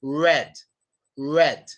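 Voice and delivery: "red" drawn out, tongue touching nothing; untouched English R. Shown as a demonstration. Teacher modelling word-initial R, lips rounded on "red". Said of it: "Your tongue touches nothing".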